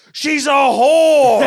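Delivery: drawn-out high, very loud holler; trucker's shout. Retold or imitated. Trucker warning driver at gas station, hollering.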